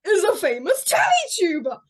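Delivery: loud, high announcement of discovery; big mock-impressed outburst; mock astonishment. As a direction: exclaim it in hammed-up awe. Imitating him discovering the fame.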